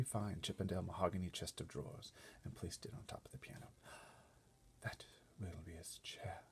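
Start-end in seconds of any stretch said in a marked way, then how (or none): 4.8-6.4 soft slow unvoiced whisper. A narrator's reading. Butler whispering orders to footmen, hushed. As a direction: breathe it like a discreet butler. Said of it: "Mister Tibbs whispered".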